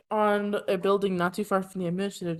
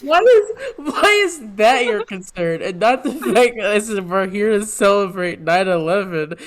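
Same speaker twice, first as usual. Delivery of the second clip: laughing; 3 semitones higher, 6 dB louder than usual. Real, not acted.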